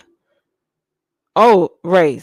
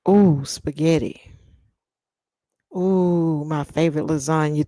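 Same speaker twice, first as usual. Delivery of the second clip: normal talking voice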